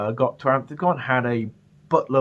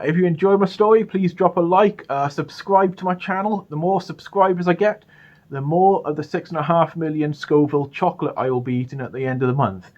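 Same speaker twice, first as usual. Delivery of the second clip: higher, louder channel sign-off; upbeat outro; 5 semitones higher, 4 dB louder than usual. Acted. Youtuber urging viewers to subscribe, upbeat and loud. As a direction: sell the subscribe and the livestream.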